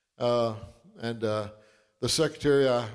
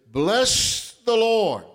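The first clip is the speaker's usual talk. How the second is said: slow, raised opening of a Psalm; scripture reading; 7 semitones higher, 4 dB louder, much slower than usual. Acted. Pastor opening Psalm to his flock, slow and raised. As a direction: draw out the blessing slowly.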